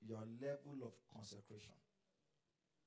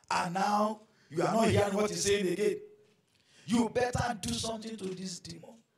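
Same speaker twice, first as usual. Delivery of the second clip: louder, higher taunting voice of the tempter; tempting voice; 6 semitones higher, 19 dB louder than usual. Acted. Preacher voicing the tempter, louder and higher taunting. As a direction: goad him to act against the demon. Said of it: "something else said to him".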